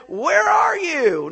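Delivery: God calling loudly, drawn out. Acted. Preacher voicing God calling to Adam, loud and drawn out. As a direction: call out across the garden.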